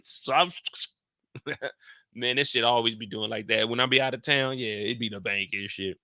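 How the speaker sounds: usual speaking voice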